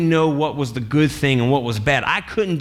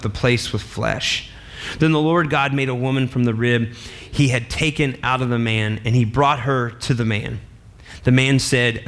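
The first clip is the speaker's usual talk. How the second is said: lower, measured Bible reading; scripture reading; 5 semitones lower than usual. Acted. Preacher reading Genesis to the congregation, solemn and measured. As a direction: read the verses out with solemn weight.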